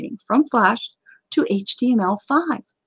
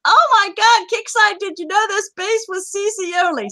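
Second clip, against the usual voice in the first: mock alarmed player; 9 semitones higher than usual. Host mimicking players reporting to Kixeye, mock alarm. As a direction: imitate a breathless forum report in capital letters.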